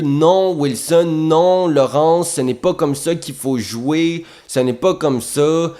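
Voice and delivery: slow, high, lofty mock coach voice; pompous coach. Imitating the proud coach scolding the young actors, slow and lofty. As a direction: lecture them grandly, full of pride. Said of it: "elle se pétait un orgueil".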